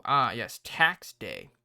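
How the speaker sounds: ordinary talk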